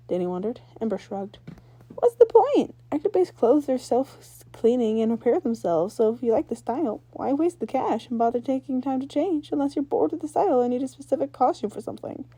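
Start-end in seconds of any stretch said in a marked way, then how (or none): 1.9-12.2 put-on higher, louder young rocker girl; confident girl explaining her gear; Ember's voice. Ember shrugging it off.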